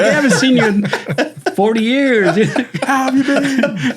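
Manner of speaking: high, laughing, delighted reunion cry; excited greeting. Retold or imitated. Imagining hugging his old babysitter in court, high laughing greeting. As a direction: gush at her like a long-lost friend.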